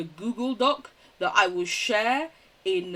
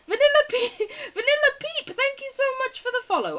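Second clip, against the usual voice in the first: much higher, chirpy thank-you; bright thanks; 6 dB louder than usual. Real, not acted. Thanking a new follower with a high, chirpy, delighted voice. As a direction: greet them with delight.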